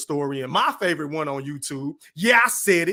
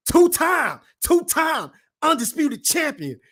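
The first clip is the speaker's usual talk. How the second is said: higher announcer-style Booker T hype; 10 semitones higher, slower than usual. Retold or imitated. Streamer hyping Crawford as Booker T, higher-pitched hype. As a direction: stretch out undisputed like a wrestling promo. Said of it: "in my Booker T voice".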